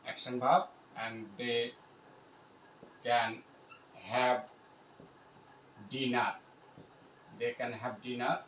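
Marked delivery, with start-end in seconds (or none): 1.4-6.3 spaced-out dictation; word by word. Shown as a demonstration. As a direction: pause at length after each word. Spelling out example while writing.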